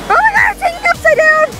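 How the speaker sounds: high, fast, loud squeal; excited squeal. Real, not acted. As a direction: squeal in amazement. Bug hangs upside down.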